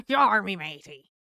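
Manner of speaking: loud, hammed-up pirate greeting; pirate accent. Shown as a demonstration. Streamer demoing a favorite accent, pirate voice. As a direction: play the pirate with gusto. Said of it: "talking in a pirate accent".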